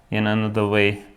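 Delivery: ordinary talk, nothing marked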